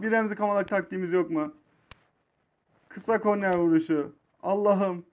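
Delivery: ordinary talk, nothing marked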